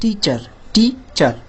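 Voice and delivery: টিচার slowly as tee... char; slow demonstration, gap between টি and চার; split in two. Shown as a demonstration. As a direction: break টিচার into two beats. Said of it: "দুই ভাগে ভাগ হয়ে যাচ্ছে".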